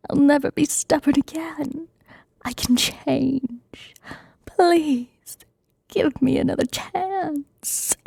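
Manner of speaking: whispered Donkey impression. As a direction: beg like Donkey, voice lifted. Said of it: "my best donkey A S M R impression".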